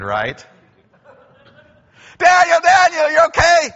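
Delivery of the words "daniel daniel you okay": high, loud, desperate shout; a frantic king shouting down into the lions' den; frantic call. Acted. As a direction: yell down into the pit in panic.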